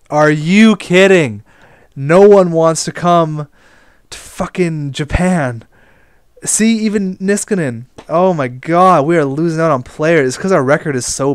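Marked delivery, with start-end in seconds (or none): 0.1-5.7 loud, slow, exasperated yelling; mad outburst. Real, not acted. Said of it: "I'm sorry, I am mad". 6.4-10.3 higher, louder frustrated complaining; exasperated at losing every player; mad rant. Real, not acted. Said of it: "I'm sorry, I am mad".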